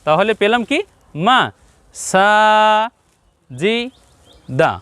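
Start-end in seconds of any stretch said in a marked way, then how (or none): 1.1-4.7 মা short, সা long, জি and দা short; short and long syllables. Shown as a demonstration. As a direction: spell out মা, সা, জি, দা one by one, stretching only সা. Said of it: "সিনকে একটু বেশি করে বলছি".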